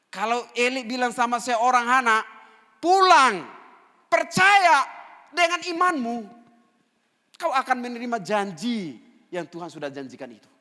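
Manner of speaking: high, thundering preacher's proclamation; full-force preaching. Acted. Eli sending Hana home to believe, proclaimed at full force. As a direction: shout each phrase as a separate command, then ease.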